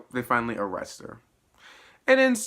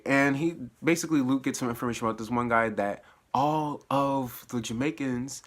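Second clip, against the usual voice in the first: ordinary talk, nothing marked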